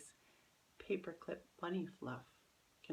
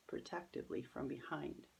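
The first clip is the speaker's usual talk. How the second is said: ordinary delivery, nothing marked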